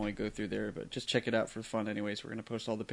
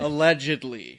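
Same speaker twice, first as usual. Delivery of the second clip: arch allegedly; 3 semitones higher, 7 dB louder, slower than usual. Acted. Joking about guest's reputation.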